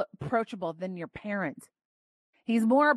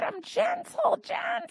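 high, pleading cry of gentle; the preacher's voice climbing high as she pleads gentleness. Acted. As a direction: plead it high and strained, almost breaking. Voicing Jesus, pleading.

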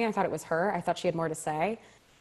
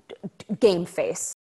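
ordinary talk, nothing marked

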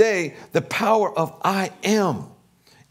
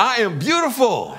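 higher and louder, proclaiming each word; leading affirmation, raised; 5 semitones higher, 3 dB louder than usual. Real, not acted. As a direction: call it out for the room to echo. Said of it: "you can affirm with me".

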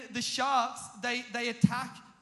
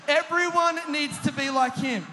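louder, higher mock-coach shout; imitating an instructor's emphatic public praise; proclaiming instructor; 4 semitones higher, 6 dB louder than usual. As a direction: call it out to the whole group.

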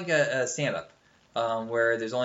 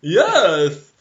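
loud, higher single yes; Ed Wynn reply; 6 semitones higher, 14 dB louder than usual. Retold or imitated. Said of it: "And he goes".

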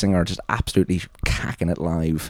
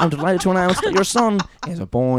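higher, laughing mock bulletin voice; newsreader announcing birth; 7 semitones higher than usual. Host imagining a newsreader announcing a baby, higher mock bulletin voice. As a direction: deliver family news in newsreader cadence. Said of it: "Imagine if one of them".

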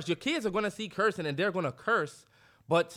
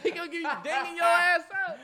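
high-pitched words through laughter; laughing tease; 11 semitones higher, 4 dB louder than usual. Real, not acted. Teasing a dad about his son beating him, laughing. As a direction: crack up while teasing, voice shooting up.

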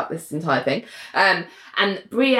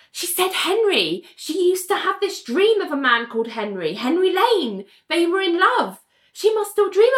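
high, eager retelling of a discovery; imitated breathless-with-news friend, pitched way up; acted excitement; 11 semitones higher than usual. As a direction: play it as an astonished revelation. Acted amazed revelation to Robert.

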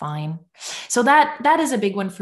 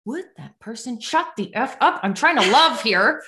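retold exasperated inner rant, raised pitch; comically fed up, pitched up; mock annoyance; 4 semitones higher, 4 dB louder than usual. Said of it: "I was getting really annoyed".

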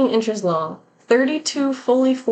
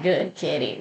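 "Good kitty" said lower and softer, a mild creepy ghost voice; 6 semitones lower, 5 dB quieter than usual. Retold or imitated. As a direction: lightly voice the creepy intruder, dropping low and soft on "Good kitty". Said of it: "I'm sick and tired of that creepy voice".

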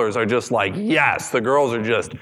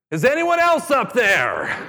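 high, slow desperate call for someone else; hoping for another answer, call out slowly; crying out; 11 semitones higher than usual. A narrator's reading. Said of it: "then he cried out".

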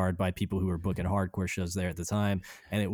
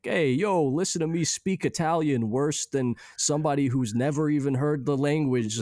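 mock Italian accent; 6 semitones higher than usual. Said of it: "as if they were guessing what Italian sounded like".

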